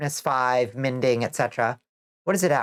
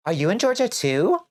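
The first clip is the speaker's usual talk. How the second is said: surprised; 8 semitones higher than usual. Real, not acted. Chatter from Georgia too.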